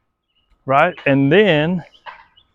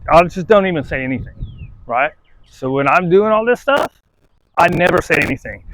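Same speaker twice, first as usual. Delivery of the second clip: ordinary talk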